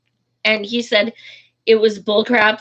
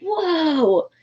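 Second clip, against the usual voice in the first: startled whoa; 7 semitones higher than usual. Retold or imitated. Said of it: "I was just like".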